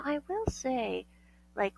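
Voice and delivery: ordinary talk, nothing marked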